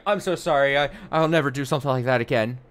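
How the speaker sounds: high, loud, rushed fake-sorry mother; mocking apology. Abusive mother promising never again, mocked in a high, fast voice. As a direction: ham up a guilty mother's hurried apology. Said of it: "and be like".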